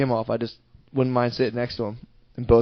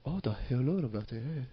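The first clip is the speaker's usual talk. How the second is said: softer, badly faked Russian; mock Russian accent; 10 dB quieter than usual. Shown as a demonstration. Host shows off Russian on request, in dubious accent. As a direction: mumble it softly as pretend Russian. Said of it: "Do some more Russian right now".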